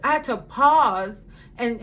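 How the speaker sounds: ordinary delivery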